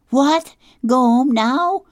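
high, loud, incredulous cry; girl aghast at the idea of going home; shocked. Acted. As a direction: leap up in disbelief.

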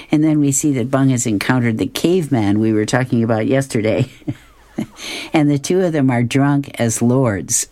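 normal talking voice

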